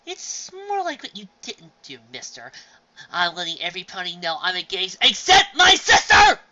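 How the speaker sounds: high, loud indignant sister; indignant scolding. Acted. Sister scolding her brother for not telling her, indignant. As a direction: scold him, hurt and offended.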